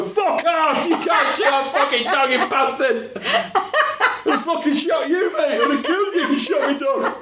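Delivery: high furious yelling, breaking into laughter; angry shout. Retold or imitated. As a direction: swear at the farmer, laughing as you relive it. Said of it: "We just go".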